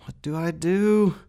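high, loud panicked plea; scared, helpless cry; frightened. A narrator's reading. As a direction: plead for help in rising terror. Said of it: "stammered Rat".

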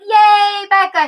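bright, loud little cheer; excited cheer. Retold or imitated. Cheering a friend's new path.

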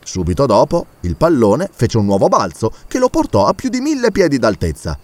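usual speaking voice

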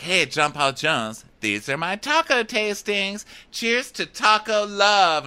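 high, slow sing-out; mock perky flirting. Co-host as Jane offering taco tastings, mock perky.

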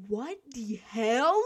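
slow, drawn-out incredulous question; retold stunned reaction; astonished disbelief.

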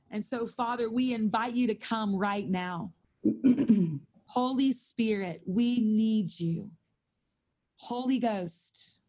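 intercessory prayer to the Lord; earnest prayer. Acted. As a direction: pray it out over the room. Leads the prayer.

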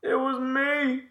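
slow, high, drawn-out confession; hammed-up culprit, savouring each word; mock villain reveal. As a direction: stretch out the big reveal in a high put-on voice.

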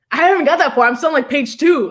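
half-laughing complaint that he hasn't read that far; exasperated protest. Retold or imitated.

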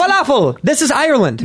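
high, Jamaican-sounding Irish welcome; singsong fake Irish gone Jamaican; botched Irish accent. Shown as a demonstration. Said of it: "that sounds so Jamaican".

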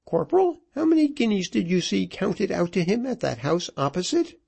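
high-pitched, taunting tirade; widow in a rage. A narrator's reading. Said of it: "working herself into a rage".

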